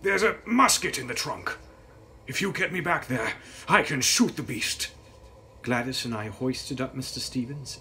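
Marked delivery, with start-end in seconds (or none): 0.0-4.9 higher, louder strained voice; pained coachman. A narrator's reading. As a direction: push the words out through pain. Injured coachman speaks.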